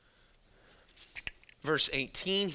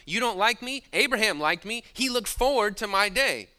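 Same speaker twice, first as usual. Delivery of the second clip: higher, louder, emphatic retort; pointed challenge; 7 semitones higher, 6 dB louder than usual. Retold or imitated. Preacher voices Jesus contrasting Abraham with the leaders, pointed challenge. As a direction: push back forcefully at the critics.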